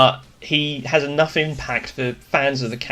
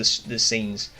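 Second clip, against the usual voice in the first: usual speaking voice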